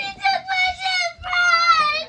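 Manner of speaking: sobbing, loud and slow; crying shout. Real, not acted. Child sobbing loudly to her parent. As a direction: wail the complaint.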